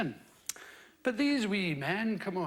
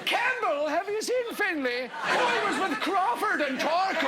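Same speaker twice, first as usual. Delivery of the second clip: mock clan member calling; 7 semitones higher than usual. Mocking surname clan voices.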